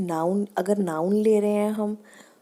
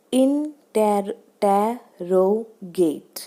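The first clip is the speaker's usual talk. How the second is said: Interrogate very slowly, each syllable set apart; much slower than usual. Shown as a demonstration. Teacher showing the syllable count, slow and separated. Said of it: "यहाँ पे चार है".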